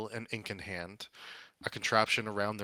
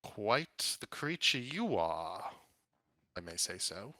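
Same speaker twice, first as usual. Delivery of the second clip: lightly acted, drawn-out appraisal; appraising red wizard; slower than usual. Red wizard sizes up Roboticus, lightly acted and drawn out. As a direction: stretch it slowly, then drop away on the aside.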